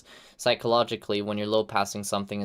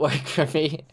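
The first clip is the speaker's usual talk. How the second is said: laughing through the words; real giggle mid-sentence; amused; 6 semitones higher, 4 dB louder than usual. Real, not acted. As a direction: let a laugh break into the apology. Laughing at a weak performance.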